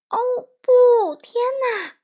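very high, loud alarmed yell; mother badger crying out in alarm; cry of dismay. A narrator's reading. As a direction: shriek as the pancakes vanish. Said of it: "獾妈妈大叫".

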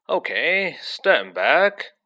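loud, showy teacher; puffed-up celebrity wizard; Lockhart voice. A narrator's reading.

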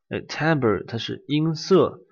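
ordinary talk

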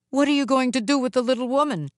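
worried statue speaking fast; Jizo, concerned. Acted. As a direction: challenge the Oni in a hurry. Jizo questions the Oni.

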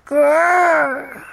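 little toddler growl, mock scary; pretend dinosaur growl. Toddler [name] growling as a dinosaur, playful mock growl.

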